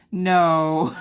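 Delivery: high burst of laughing; real laughter. Real, not acted. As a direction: laugh out loud at yourself. Laughing at herself.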